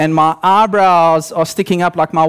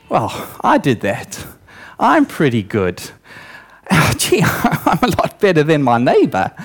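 low, self-satisfied musing with chuckles; mock smugness; 6 semitones lower than usual. Acted thoughts of the self-righteous.

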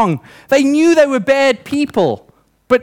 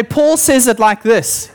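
ordinary talk

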